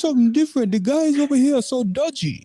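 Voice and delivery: high, put-on Irish accent; hammed-up Irish lilt, voice up high; mock Irish woman. Irish women quoted.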